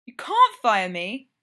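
loud, slow, indignant bluster; a slow, loud, offended splutter. A narrator's reading. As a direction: puff up and protest loudly. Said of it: "the nurse blusters".

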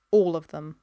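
low, even, final answer; firm confirmation. A narrator's reading. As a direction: confirm it without budging. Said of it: "he said at last".

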